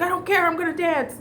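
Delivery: defiant insistence. Retold or imitated. Telling the boss she will dance anyway, defiant. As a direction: dig in your heels and refuse to listen. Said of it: "I was like".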